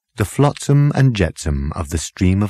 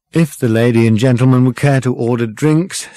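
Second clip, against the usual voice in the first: somewhat louder, higher polite interjection; formal waiter; 5 semitones higher, 5 dB louder than usual. A narrator's reading. Waiter offers drinks to the guests, formal. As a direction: cut in with stiff courtesy.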